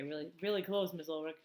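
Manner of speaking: usual speaking voice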